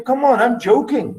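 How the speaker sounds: high, light, teasing voice brushing it off; playful reassurance. Retold or imitated. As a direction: laugh it off, it was only a joke. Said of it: "But I say to you".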